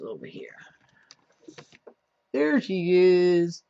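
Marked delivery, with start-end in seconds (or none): 2.3-3.6 high, loud, slow sing-song voice to a dog; pet talk. Real, not acted. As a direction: coo at the dog, drawn out. Talking to his dog Nola.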